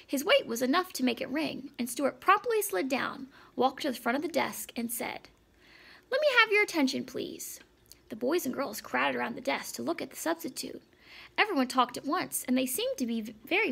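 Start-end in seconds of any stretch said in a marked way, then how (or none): 6.1-7.6 raised, higher call for attention; calling to order. A narrator's reading. Substitute calls class to order.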